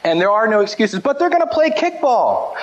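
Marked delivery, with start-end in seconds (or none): 1.0-2.5 put-on whiny kid excuse. Kid's excuse to skip dinner.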